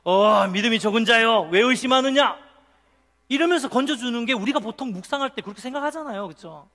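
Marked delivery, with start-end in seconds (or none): 0.0-2.4 loud, acted stern rebuke; reproachful. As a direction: rebuke a doubter with force. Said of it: "막 책망하시면서". (책망하듯 큰 소리로)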